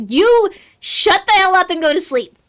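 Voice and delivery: furious yell to go to sleep; shouting. Acted. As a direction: snap at them to shut up. Player yells at character.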